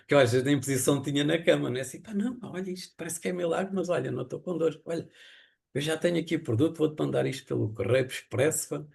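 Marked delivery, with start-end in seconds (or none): 2.0-8.8 high, eager voice bursting with good news; excited retelling. Retold or imitated. Telling his wife on the phone the pain is gone, excited and high. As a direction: let it tumble out, thrilled.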